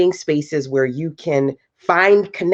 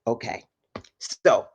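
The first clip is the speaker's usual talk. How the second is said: usual speaking voice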